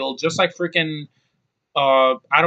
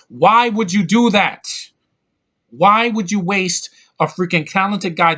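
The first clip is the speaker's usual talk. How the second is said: angry outburst; 5 semitones higher, 5 dB louder than usual. Real, not acted. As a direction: shout it at the screen in disbelief. Ranting at the movie.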